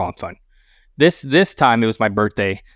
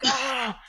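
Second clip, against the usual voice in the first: pained cry; 13 semitones higher, 8 dB quieter than usual. Retold or imitated. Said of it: "I'm like".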